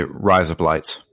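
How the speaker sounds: "Rise up, lights" quick and run together; said fast. Shown as a demonstration. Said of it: "you have to say it fast".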